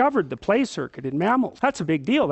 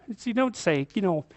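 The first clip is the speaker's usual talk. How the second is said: usual speaking voice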